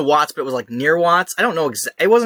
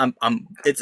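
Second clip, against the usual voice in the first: usual speaking voice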